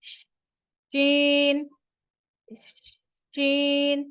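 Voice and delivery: slow model "शीन"; letter demo. Shown as a demonstration. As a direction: draw out the sh of "शीन". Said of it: "वो है हमारे पास".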